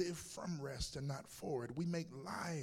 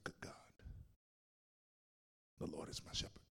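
awed whisper; 11 semitones lower, 9 dB quieter than usual. Retold or imitated. Groom's awed inner prayer.

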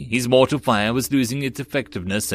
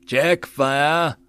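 mildly roared command, raised pitch; roared order; 4 semitones higher than usual. A narrator's reading. Sergeant to his Marines over gunfire, mild roar. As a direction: call over the din with some restraint. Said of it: "the sergeant roared out over the din".

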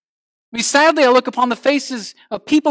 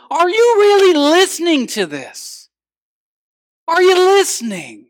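raised, high-pitched demand; urgent challenge; 8 semitones higher, 5 dB louder than usual. Acted. Preacher voicing scripture to the congregation, loud and urgent.

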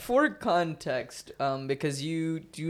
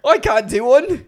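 loud, clumsy attempt at an Irish accent; bad Irish accent; 7 semitones higher, 11 dB louder than usual. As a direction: try an Irish accent and miss badly. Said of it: "That's a terrible Irish accent".

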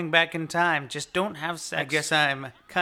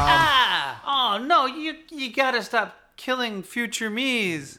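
shocked; 7 semitones higher, slower than usual. Real, not acted. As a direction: yelp in shock at the shot. Reacts to a shooting.

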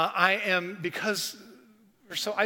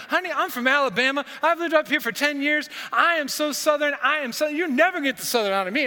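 put-on higher, louder Southern accent with a laugh; 8 semitones higher, 5 dB louder than usual. Target clerk telling the pastor about Alabama, Southern accent. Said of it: "This is my Southern accent".

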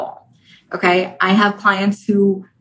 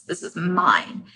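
quiet possessive; 3 semitones lower, 7 dB quieter, slower than usual. Acted. Ego quote, quiet slow take.

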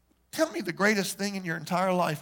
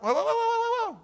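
high whoa; 14 semitones higher than usual. Real, not acted. Mock-stopping an objector.

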